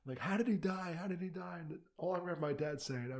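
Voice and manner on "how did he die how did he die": insistent repeated demand, first take higher; retold angry demand to his family; indignant. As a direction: press the question twice. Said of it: "I'm all indignant".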